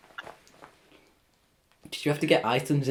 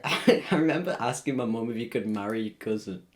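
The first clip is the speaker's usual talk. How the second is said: usual speaking voice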